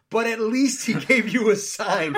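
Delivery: laughs through the punchline; laughing. Real, not acted. Telling the woman God's sign, laughing. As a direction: breaks into laughter at his own joke. Laughs at his own joke.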